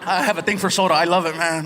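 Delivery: usual speaking voice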